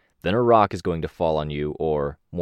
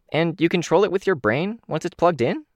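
higher, eager Kenzie voice; bright eager kid character, higher; 11 semitones higher than usual. Acted.

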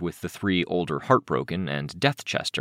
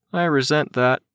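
usual speaking voice

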